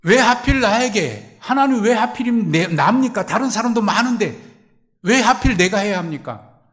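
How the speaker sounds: a loud, high cry of why me; complaining cry. Acted. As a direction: wail why me at God. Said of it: "이렇게 질문할 때가 있어요".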